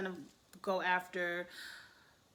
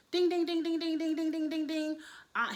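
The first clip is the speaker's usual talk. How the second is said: high, loud dinging like alarm bells; 8 semitones higher, 4 dB louder than usual. Retold or imitated. Host mimicking alarm bells at a red flag. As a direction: ring out ding ding ding like a warning bell.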